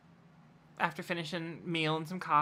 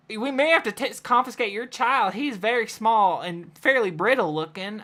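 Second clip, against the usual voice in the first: sheriff; 5 semitones higher, 5 dB louder than usual. Acted. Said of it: "Sheriff Jackson speaks up".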